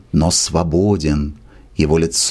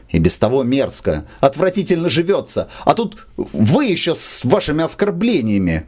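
agitated, rising higher as the complaint builds; excited; 6 semitones higher than usual. Acted. Брыкович pacing the room, complaining agitatedly. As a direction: pace and vent the insult in a raised voice. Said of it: "возбуждённо шагая".

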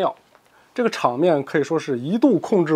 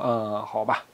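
low, soft sigh of agreement; weary assent; 9 semitones lower, 9 dB quieter, slower than usual. Acted. Hong Qigong agreeing to wait, low and tired. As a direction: give in wearily. Old man gives in wearily.

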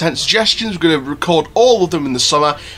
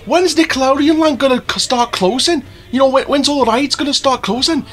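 eager viewer voice; 9 semitones higher than usual. Retold or imitated. Voicing viewers' imagined question.

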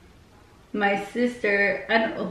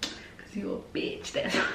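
low, softer, half-whispered jab; sly low dig at her sister; snide aside; 6 semitones lower, 9 dB quieter than usual. Retold or imitated. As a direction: mutter the insult under your breath. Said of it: "I was like".

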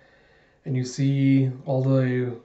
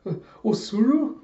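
high, rising, puzzled call; citizens' surprised, high-pitched question; startled question; 10 semitones higher than usual. Acted. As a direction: ask it in startled disbelief. Citizens surprised to see Osuru.

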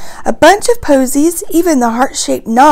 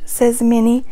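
usual speaking voice

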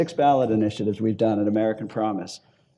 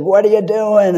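a mock Maine accent, pitched up and louder; 9 semitones higher, 6 dB louder than usual. Maine voter at the farmers market asks what he's doing, in a Maine accent. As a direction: imitate the Maine voter's Maine accent, high and eager. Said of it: "I'm not making fun of that accent".

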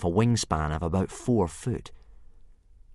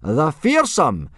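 loud, slow, raised billing; drawn-out ring-announcer shout; forced enthusiastic yelling; 9 semitones higher, 10 dB louder, slower than usual. A narrator's reading. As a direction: yell out each grand title with effort. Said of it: "trying to put a bit of enthusiasm into his yelling".

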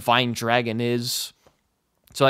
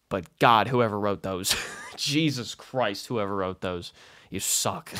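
muttered grumble; 6 semitones lower, 6 dB quieter than usual. Real, not acted. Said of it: "grumbling at writers".

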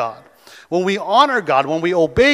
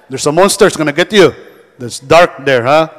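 louder mock monster warning; scaring relatives; 3 semitones lower, 7 dB louder than usual. Relative frightening a kid at night, mock scary tone. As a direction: spook the child, teasing. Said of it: "Who always say that?".

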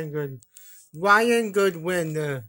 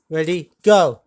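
loud call; 3 semitones lower, 9 dB louder than usual. Pretend. Calling out start.

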